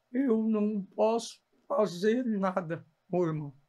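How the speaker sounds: slow mock slur of a stroke-stricken mouth; slurred, half-paralysed speech. Father mid-stroke answering his son, slow slurred mumble. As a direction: imitate a mouth that can't move properly, slowly. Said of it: "com a boca meia mole, que ela não conseguia falar direito".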